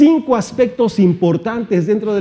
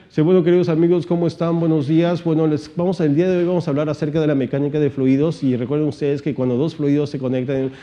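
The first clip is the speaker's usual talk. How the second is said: boring lecturer rattling on in a monotone; imitating a dull professor, running on evenly; sleep-inducing professor; faster than usual. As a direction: drone through the lecture without emphasis. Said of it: "hablaba así, imagínate ese tono de voz".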